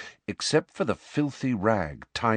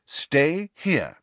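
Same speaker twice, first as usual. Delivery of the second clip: firm; 7 semitones higher than usual. A narrator's reading. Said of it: "she said firmly".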